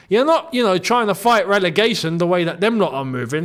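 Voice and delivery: ordinary talk, nothing marked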